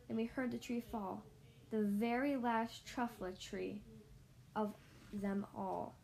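ordinary delivery, nothing marked

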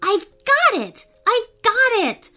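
put-on high, excited child's cry; excited shout. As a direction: shout it with a bright idea. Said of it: "Caleb suddenly shouted".

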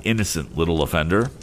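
plain reading voice

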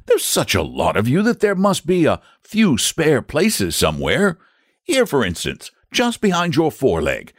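high-pitched, coaxing knight; reasonable knight. Acted. Saint George picks a painless spot to spear, coaxingly.

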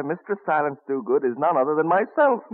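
usual speaking voice

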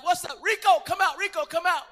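friends calling out. Retold or imitated. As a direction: holler for Rico to come out. Friends calling him out from creeper.

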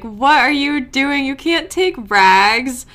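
high, loud, slowed plea; anguished objection to the dog being taken; upset protest. Retold or imitated. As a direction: beg her not to take the dog. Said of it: "I was so upset about that".

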